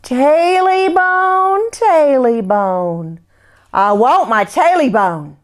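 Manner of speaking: slow, drawn-out ghostly chant; eerie creature voice. Acted. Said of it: "a voice just a little louder".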